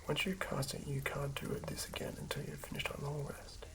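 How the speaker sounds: normal talking voice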